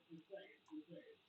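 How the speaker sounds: breathy whispered aside, Just saying under the breath. Acted. Teasing viewers about Scouse pilots, whispered.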